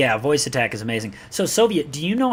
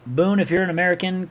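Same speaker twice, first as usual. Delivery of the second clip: normal talking voice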